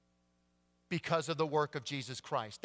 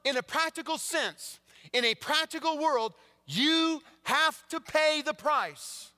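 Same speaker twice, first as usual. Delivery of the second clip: fervent preaching; 12 semitones higher, 6 dB louder than usual. Acted. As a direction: drive the warning home. Preaching surges again.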